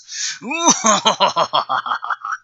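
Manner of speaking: loud, high manic villain laugh, falling away. A narrator's reading. Necron gloating at the teens, manic laugh. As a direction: cackle openly at the powerless heroes. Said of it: "and then out loud".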